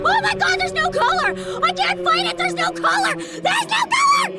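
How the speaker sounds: high, loud panic rising to a shriek; panicked shrieking. Real, not acted. Streamer panicking at an unbeatable enemy, high and shrill. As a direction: cry it out in full alarm.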